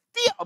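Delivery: high excited fan shriek; a fan shrieking at an idol; fangirl squeal. Retold or imitated. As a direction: squeal with delight. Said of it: "뭐 이렇게 하겠죠".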